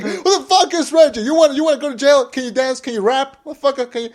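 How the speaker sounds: high, rapid-fire mock interrogation; mock outraged dad. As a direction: grill him like a hammed-up angry father. Acting out fathers grilling the boyfriend.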